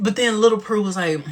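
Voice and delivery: ordinary talk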